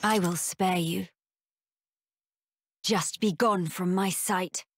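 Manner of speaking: low, measured command; stern dismissal. Acted. Melia spares her beaten foe, stern dismissal.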